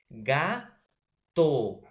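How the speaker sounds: "gato" as two separate beats, GA, pause, TO; split into syllables. Shown as a demonstration. Teacher shows students the syllables of "gato", syllable by syllable. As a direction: break "gato" after GA, each syllable punched out. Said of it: "la primera sílaba es ga".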